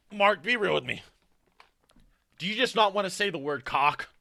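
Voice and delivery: normal talking voice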